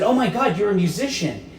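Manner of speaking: high-pitched, gushing stranger; excited passenger. Acted. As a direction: gasp it out, thrilled by a misunderstanding. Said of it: "she looked excited".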